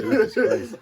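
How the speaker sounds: loud, high laughing exclamation. Real, not acted. Laughing at the friends' teasing about his ex, high and loud. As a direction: laugh it out in disbelief.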